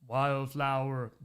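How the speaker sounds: slow, low put-on voice drawing out the name; a rough guess at his voice, dragged out slowly; Wildflower impression. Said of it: "Can you do a Wildflower impression?".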